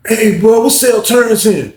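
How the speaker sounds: put-on young man calls up to the top tier; calling up.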